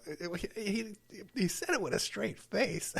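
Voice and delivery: giggling through the words, pitch up; laughing while talking. Real, not acted. Host recalling the straight-faced agent, speaking through laughter. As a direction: laugh your way through the sentence.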